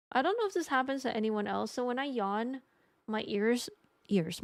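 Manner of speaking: usual speaking voice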